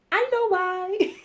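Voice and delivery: high, loud, stretched-out interjection; drawn-out squeal; giddy aside. Real, not acted. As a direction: sing out knowingly, high and slow.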